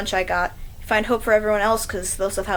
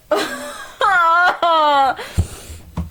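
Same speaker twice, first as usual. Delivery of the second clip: high wailing sob; sobbing wail; 7 semitones higher, 4 dB louder than usual. Acted. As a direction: let out a long sob. Sobbing laugh fit.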